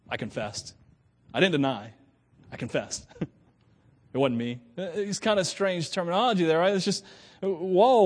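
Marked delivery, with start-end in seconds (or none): 0.1-4.6 low, understated deadpan; mock confession. Accused of stealing paper clips at work, mock confession. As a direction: confess it drily, mostly soft and low.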